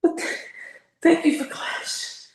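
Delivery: high, tight voice on the edge of tears; choked up and barely holding it together; getting emotional. Real, not acted. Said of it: "I'm getting a little emotional here".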